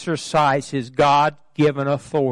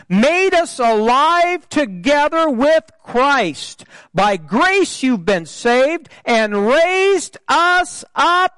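high-pitched, emphatic scripture preaching; ringing sermon delivery, stretching big words; forceful preaching; 10 semitones higher, 4 dB louder than usual. Real, not acted. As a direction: preach it out, punching the key phrases.

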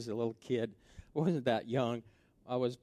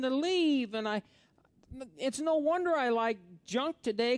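high, loud preaching voice; fired-up pulpit delivery; full-force preaching; 9 dB louder than usual. Acted. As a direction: preach it out big to the congregation.

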